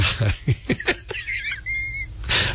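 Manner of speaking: high breathy laugh. Real, not acted. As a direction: burst of laughter. Host laughs after dropped caller.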